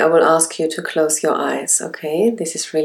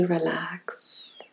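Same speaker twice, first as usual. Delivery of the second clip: breathy whispered relax; 10 dB quieter than usual. Acted. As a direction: breathe the word out, unvoiced. Whispered cue in meditation.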